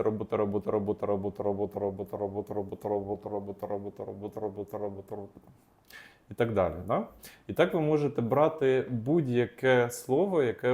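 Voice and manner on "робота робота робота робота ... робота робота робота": fading repetition drill; rapid repetition fading out. Shown as a demonstration. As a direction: repeat «робота» quickly, getting softer until it fades. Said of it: "двадцять-сорок секунд ви промовляєте це".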